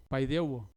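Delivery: paideuo said with the U accented; stress on the U. Shown as a demonstration. Preacher repeating the Greek word, paideuo stressed on U. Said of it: "con acento en la U".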